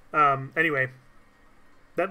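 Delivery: ordinary delivery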